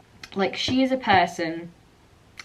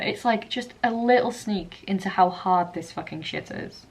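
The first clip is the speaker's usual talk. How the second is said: usual speaking voice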